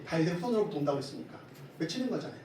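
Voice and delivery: ordinary talk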